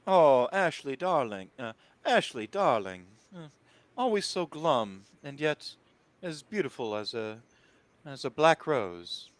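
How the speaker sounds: a touch of grand, darling-dropping hostess; lightly voiced diva paying a barbed compliment; theatrical Annabelle, admiring. Game master plays Annabelle.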